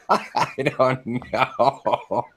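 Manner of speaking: slow, drawn-out words through real laughter; can barely say it for laughing. Real, not acted. Cracking up at the story.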